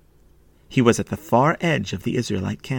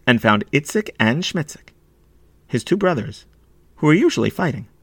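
ordinary delivery, nothing marked

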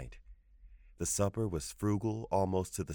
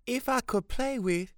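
put-on very high, urgent boy's voice; pleading boy; 4 dB louder than usual. A narrator's reading. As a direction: start begging, then get cut off. Boy interrupted by grandmother.

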